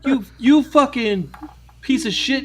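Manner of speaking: high-pitched mock tirade; halting, high-pitched insults, imitating an irate stranger; imitated yelling. Stranger berating the crazy customer, high mock yell. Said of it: "starts yelling at this dude".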